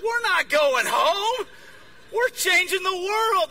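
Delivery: loud, high, drawn-out protest; retold zealous refusal, slow and emphatic; rally them, stretching the words; fired up. Fired-up convert refuses going home.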